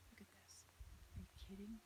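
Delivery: hushed amazement at the rainbow; awed hush. Real, not acted. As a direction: breathe it out in wonder. Awed again by the sky.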